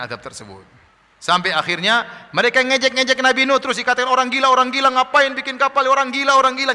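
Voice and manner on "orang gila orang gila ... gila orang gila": high, loud repeated taunts; mocking. The people keep calling Noah a madman, mocking. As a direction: keep jeering in a raised voice. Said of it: "ngejek terus".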